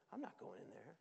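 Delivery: low, soft pouty mumble; sulky refusal. Acted. Older brother refusing to join the party, sulking. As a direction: sulk it like a pouty sibling.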